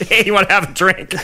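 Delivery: laughing. Retold or imitated.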